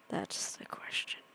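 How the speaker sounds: breathy whispered repeat; hushed spooky whisper. Shown as a demonstration. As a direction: whisper it like a secret. Whispered repeat of own line.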